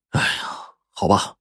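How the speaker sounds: soft, sighed-out concession; long sigh. A narrator's reading. Villager giving in to his friend's plea, with a long sigh. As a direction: sigh deeply, then give in quietly. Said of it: "长叹了一声".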